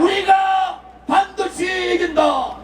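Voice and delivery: slow, shouted slogan line; roaring rallying speech. Real, not acted. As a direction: lead the crowd chant at full voice. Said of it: "제가 선창할 테니까 따라해 주시기 바랍니다". (천천히 크게 외치는 선창 구호)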